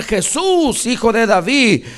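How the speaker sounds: loud, high repeated plea for mercy; preacher's full-voiced reenacted cry; imitated crying out. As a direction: cry out like a blind beggar desperate to be heard. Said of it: "a clamar".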